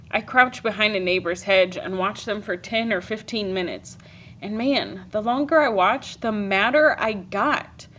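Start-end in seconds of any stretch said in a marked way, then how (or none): none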